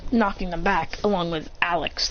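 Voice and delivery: ordinary delivery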